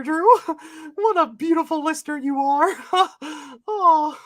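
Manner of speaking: high cartoon mouse greeting; Mickey Mouse voice. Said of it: "you didn't do Mickey's voice".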